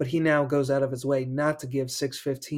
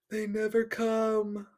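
higher, slow gasping ghost voice; tormented gasp; 8 semitones higher, slower than usual. Acted. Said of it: "the tormented voice gasped".

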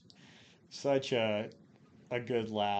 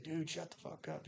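high, hushed imitation of a scared yell; mock scared scream; 6 semitones higher, 10 dB quieter than usual. Scared team leader to teasing teammates, subdued mock scream. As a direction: imitate a frightened teammate, high but soft. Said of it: "screaming and shit".

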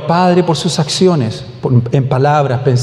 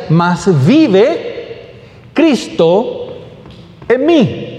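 "mas vive... Cristo... en mí", with pauses, higher on "Cristo" and "mí"; emphatic verse reading; 5 semitones higher, much slower than usual. Real, not acted. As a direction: break "mas vive / Cristo / en mí" into weighty, raised pieces.

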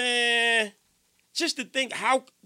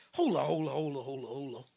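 fading hold on; 6 semitones lower, 7 dB quieter, faster than usual. Retold or imitated. Stunned realization mid-story.

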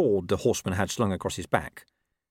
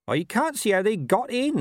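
high-pitched farmer voice; puzzled farmer; 12 semitones higher, 3 dB louder than usual. Acted.

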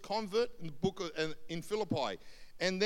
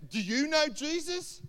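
high, loud mock-earnest question; imitated heckling, pitched way up; mocking shopkeeper; 10 semitones higher, 9 dB louder than usual. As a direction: call across the shop to tease. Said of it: "he's a real mocking kind of guy".

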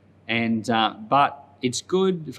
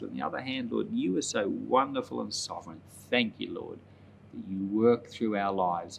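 soft, reverent prayer voice, fading quieter; closing prayer; 4 dB quieter than usual. Real, not acted. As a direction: pray softly to close the service. Pastor closes with prayer.

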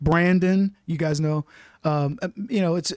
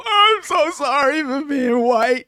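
higher, louder, slow mock sobbing; hammed-up tearful confession; mock guilty wail; 7 semitones higher, 4 dB louder, slower than usual.

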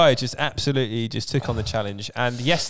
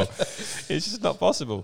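slow, softer, laughing; quiet laughing repeat; 3 semitones higher, 4 dB quieter, slower than usual. Real, not acted. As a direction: let it fade slowly through laughter.

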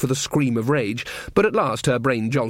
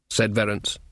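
ordinary talk

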